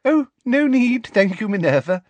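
cloying high-pitched simper. A narrator's reading. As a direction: decline with girlish fake sweetness. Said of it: "simpered Professor Umbridge".

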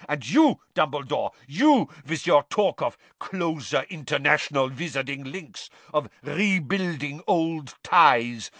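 put-on rising, scornful foreign-accented rant; Karkaroff's contempt. Karkaroff to Dumbledore, rising into a scornful shout. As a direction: build up to a spitting high outburst.

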